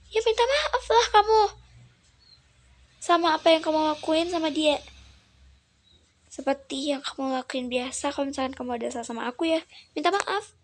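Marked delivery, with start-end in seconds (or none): 0.1-4.8 much higher, loud, furious; older sister yelling at the man to apologise; shrill angry insistence. Acted. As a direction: shout it up high in outrage.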